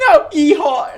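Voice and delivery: high mock yeehaw holler; a hammed-up cowboy yeehaw; cowboy drawl. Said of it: "[name], do a cowboy impression".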